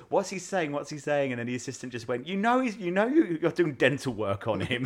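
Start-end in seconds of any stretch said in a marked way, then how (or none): 0.1-1.3 quoting the dentist. Retold or imitated. Dentist voiced asking about patient. 2.3-4.8 high, loud mock assistant voice explaining through laughter; a louder, higher playful imitation of the dental assistant; mimicking the assistant.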